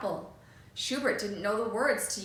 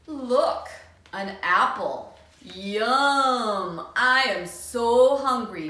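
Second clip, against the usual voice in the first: loud, eager delight; greedy grab at a treat; 8 dB louder, much slower than usual. Acted. Bully finding the apple, loud and delighted. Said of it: "Benny says with delight".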